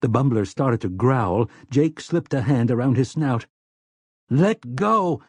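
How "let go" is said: high, pained outcry; crying out. A narrator's reading. Slightman grabbed by the robot, crying out. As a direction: cry out to be released. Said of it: "Slightman cried out".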